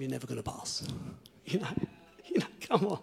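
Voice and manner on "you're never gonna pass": discouraging whisper. Retold or imitated. Voice of doubt quoted.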